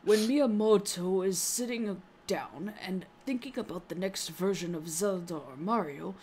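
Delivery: put-on slurring like a tipsy old man; drunk man voice. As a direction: read it like a little drunk man. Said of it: "you gotta sound like a little drunk man when you do this".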